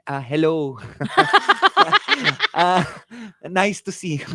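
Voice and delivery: lightly voiced Midwest accent; a hint of practised Midwest accent. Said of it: "a nice Midwest accent or something".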